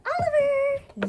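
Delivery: high bright name call; calling the puppy's name. Real, not acted. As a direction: sing out the dog's name.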